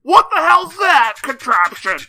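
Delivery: put-on high, loud, slow old-timer holler; Prospector. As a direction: bellow in old-timey alarm at a strange machine. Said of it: "bring back the Prospector voice".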